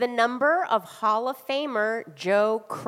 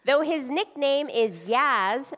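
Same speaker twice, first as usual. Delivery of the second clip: ordinary talk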